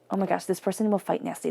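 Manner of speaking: ordinary talk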